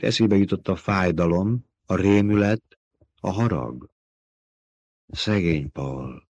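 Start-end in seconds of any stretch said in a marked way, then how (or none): 5.1-6.2 soft, slow murmur of pity; whispered pity. A narrator's reading. Narrator reading Jessica's soft whisper for her son. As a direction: breathe it out quietly.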